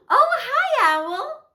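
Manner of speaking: high, loud, thrilled hello; delighted greeting. Pretend. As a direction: greet a favourite friend with playful glee. Greets pretend caller Owl.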